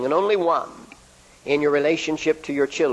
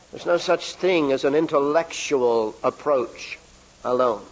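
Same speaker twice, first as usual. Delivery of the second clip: normal talking voice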